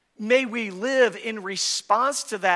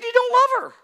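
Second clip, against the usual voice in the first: much higher, louder burst of disbelief; sudden high exclamation; 8 dB louder, much faster than usual. Retold or imitated. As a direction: cry it out in shock. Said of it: "I said".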